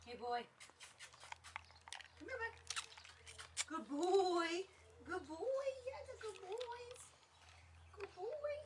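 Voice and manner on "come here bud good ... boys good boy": squeaky praising a dog. Real, not acted. Cooing at the dog.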